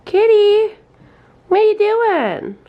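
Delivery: high, loud sing-song call to the pet; high call to the cat. Real, not acted. Calling out to the cat.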